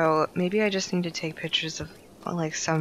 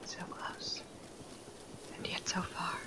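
soft unvoiced whisper; 17 dB quieter than usual. Real, not acted. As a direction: breathe it out dramatically. Aside while playing.

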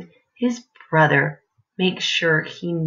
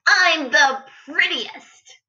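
high, slow proud cub; little lion voice; 11 semitones higher, 4 dB louder, slower than usual. A narrator's reading. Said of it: "said little lion".

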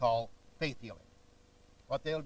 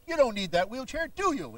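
mock faith healer; 7 semitones higher, 6 dB louder than usual. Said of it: "He says".

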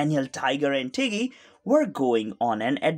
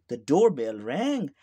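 ordinary delivery

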